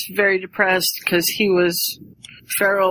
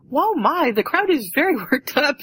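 Miss Weasley; 7 semitones higher than usual. Acted. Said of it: "Miss Weasley's like".

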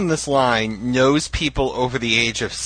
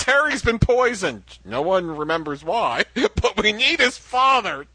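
high, hammy dramatic fic voice; melodramatic reading; 6 semitones higher than usual. Retold or imitated. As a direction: overplay it like bad fan fiction. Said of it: "But the second paragraph was".

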